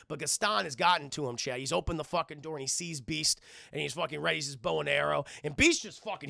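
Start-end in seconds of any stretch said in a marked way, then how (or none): none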